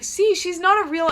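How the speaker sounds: high, loud mimicked boy; retold loud insistence. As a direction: imitate him loudly making his point.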